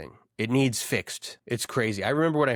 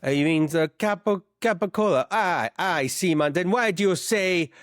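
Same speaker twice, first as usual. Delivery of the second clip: put-on sending reggaetoni for gabagool, Jersey Italian accent; New Jersey Italian accent; 6 semitones higher than usual. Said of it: "That's a half-decent accent".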